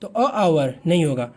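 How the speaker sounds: normal talking voice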